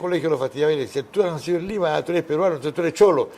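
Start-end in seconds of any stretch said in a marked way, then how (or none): 1.0-3.3 put-on raised-pitch teasing chant of kids; mock schoolyard taunt. Interviewer imitates classmates teasing Simeone, high mocking taunt.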